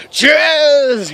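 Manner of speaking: high, very loud drawn-out yell; one long exaggerated manly bellow; manly scream. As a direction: holler the name, trying to sound macho. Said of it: "just trying to sound manly".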